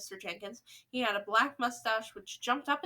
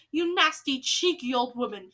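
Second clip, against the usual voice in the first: louder indignant insult; angry shout; 6 dB louder than usual. Acted. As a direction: spit the insult out loud. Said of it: "shouted Mister Jenkins".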